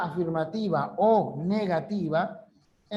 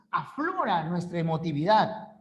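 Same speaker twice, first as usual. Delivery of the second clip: normal talking voice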